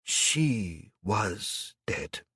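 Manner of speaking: very slow, drawn-out words. Acted. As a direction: let each word fall heavy and slow. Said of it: "he said slowly".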